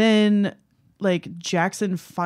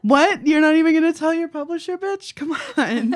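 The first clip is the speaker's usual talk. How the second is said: much higher and louder, fast, disbelieving; incredulous outrage; 10 semitones higher, 4 dB louder than usual. Real, not acted. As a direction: react as if she's lost her mind. Outraged reaction to character.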